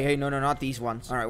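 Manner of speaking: normal talking voice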